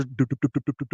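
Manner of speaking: rapid staccato rotor-blade noise; imitated helicopter rotor. Storyteller mimicking the helicopter taking off, imitated rotor noise. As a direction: mouth the chop of helicopter blades. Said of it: "And the helicopter".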